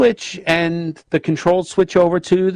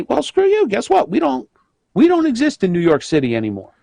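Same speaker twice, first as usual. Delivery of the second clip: high mocking defiance; defiant company; 8 semitones higher than usual. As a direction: sneer it like a fed-up boss. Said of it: "shop and say".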